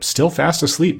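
usual speaking voice

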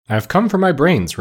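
lightly voiced, slightly uneasy request; a little uneasy. A narrator's reading. Scarecrow asking the wizard for brains, a touch uneasy. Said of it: "a little uneasily".

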